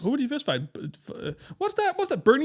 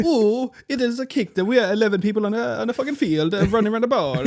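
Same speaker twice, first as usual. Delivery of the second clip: high, louder put-on European voice; mixed European accent; 7 semitones higher, 5 dB louder than usual. Explaining football to the American in a loud high put-on European accent. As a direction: ham up a vague European caricature. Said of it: "not even trying to be Italian".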